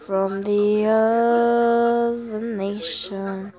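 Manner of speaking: drawn-out eerie low voice; lightly spooky chant; slow spooky voice. Pretend. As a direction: stretch it out like a ghost. Mock spooky drawn-out phrase.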